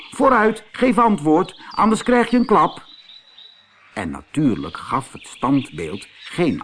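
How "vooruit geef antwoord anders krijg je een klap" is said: high and loud, a mock bully threat; angry threat. Antonio dreigt het standbeeld: hoog, luid en boos. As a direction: threaten the silent statue.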